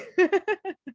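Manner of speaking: high giggle; amused high-pitched laughter. Real, not acted. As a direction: laugh at your own impression. Laughs after acting Musa's fear.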